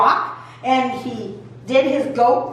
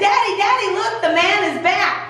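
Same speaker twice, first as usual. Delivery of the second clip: higher, eager call across the field; excited calling; 7 semitones higher than usual. Acted. Mom running to the field calling dad, excited. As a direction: mom calling out to dad, thrilled the man returned.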